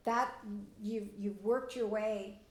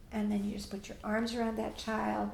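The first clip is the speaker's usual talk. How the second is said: ordinary delivery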